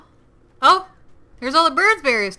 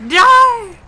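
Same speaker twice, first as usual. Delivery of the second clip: high, loud battle cry; furious yell; 9 semitones higher, 8 dB louder, slower than usual. Real, not acted. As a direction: shout them down as you fight. Fighting off birds.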